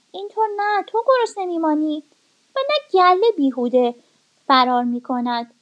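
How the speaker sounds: put-on a cunning kid goat coaxing the wolf; clever kid goat.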